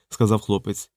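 plain reading voice

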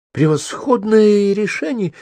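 high, slow, delighted exclamation; excited outburst. A narrator's reading. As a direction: leap up and shout it with glee. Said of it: "взвился Кока". (восторженно, высоко и протяжно)

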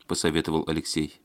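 usual speaking voice

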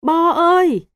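very loud, very high wail of a name; desperate scream. A narrator's reading. Father screaming for his son at the river, desperate cry. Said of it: "Anh gào lớn".

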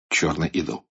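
ordinary delivery

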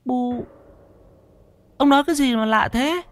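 high-pitched, anxious wife voice; fretful wife. Acted. Wife pestering her trembling husband, high and anxious. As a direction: press him with a high, worried nag.

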